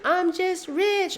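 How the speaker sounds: very high, slow singsong parody; mock positivity. Mocking someone with zeros in the bank, deluded cheer. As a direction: stretch it out in a silly high voice. Mocks deluded self-help believer.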